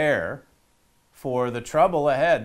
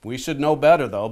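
normal talking voice

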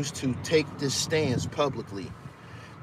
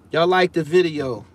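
ordinary talk, nothing marked

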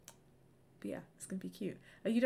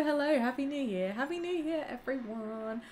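ordinary delivery

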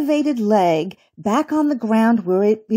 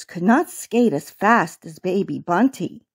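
plain reading voice

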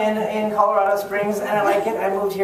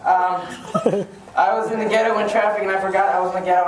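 normal talking voice

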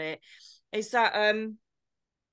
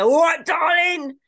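drawn-out loud shout; leering catcall; 6 semitones higher, 8 dB louder, slower than usual. Acted. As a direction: bellow it like a van driver at passing girls. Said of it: "give it the".

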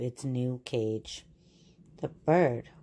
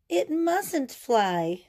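pleading; 9 semitones higher, much slower than usual. Acted. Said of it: "cried Mole".

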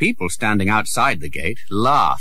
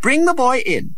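lightly voiced commanding prince; 4 dB louder than usual. Prince orders the guard.

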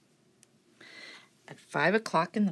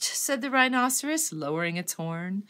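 ordinary delivery, nothing marked

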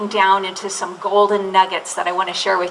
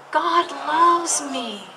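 slow unison affirmation; 6 semitones higher, 3 dB quieter, much slower than usual. Shown as a demonstration. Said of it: "Can you say that with me".

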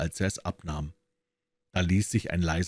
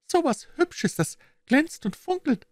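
put-on high-pitched child crying out in delight; very high, enchanted little-kid voice; delighted little girl. A narrator's reading. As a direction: marvel like a small girl at a treasure. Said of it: "rief die Kleine entzückt".

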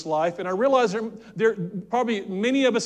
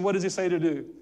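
ordinary delivery

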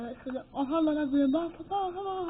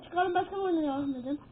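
normal talking voice

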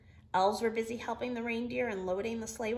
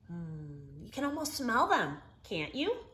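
coaxing Santa; 4 semitones lower than usual. Acted. Santa coaxes Olive about the cookies, dreamily. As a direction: tempt her with the cookie smell. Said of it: "he said".

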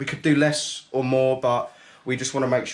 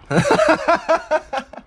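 a sudden cackling laugh; high burst of laughter; 5 dB louder than usual. Real, not acted. Friend licks chili.

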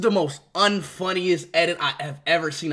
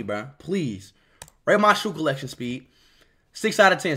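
ordinary talk

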